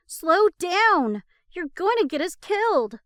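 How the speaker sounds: loud high panicked shout; yelling. A narrator's reading. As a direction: scream at him to stop. Panicked yelling at reckless driver.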